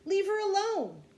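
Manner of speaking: high, very loud demand; protective yell. A narrator's reading. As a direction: yell it to defend someone. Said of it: "he kept shouting".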